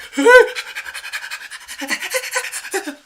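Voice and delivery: a loud, high laugh that falls away; high burst of laughter. Real, not acted. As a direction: burst out laughing, then let it fade. Laughing at the pet comparison.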